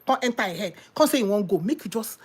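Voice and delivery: normal talking voice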